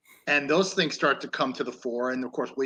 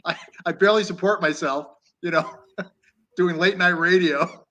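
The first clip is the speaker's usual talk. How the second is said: laughing through quote; 4 semitones higher, 7 dB louder than usual. Retold or imitated. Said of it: "he's very cynical".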